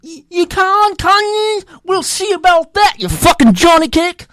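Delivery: high, loud mock English retort; indignant English ditch digger, high-pitched; English accent. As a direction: snap back in hammed-up English accent. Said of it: "this is England, okay?".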